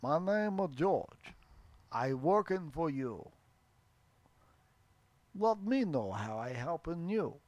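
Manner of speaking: slow, halting mock-Russian; Russian accent. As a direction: introduce yourself in put-on Russian English. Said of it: "Why does Christopher Walken keep coming".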